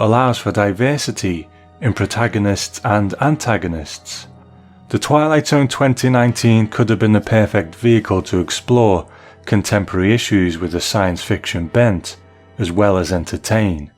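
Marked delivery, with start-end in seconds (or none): none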